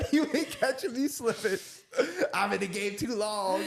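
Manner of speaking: high, laughing through the words. Real, not acted. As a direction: say it while cracking up. Repeats line laughing.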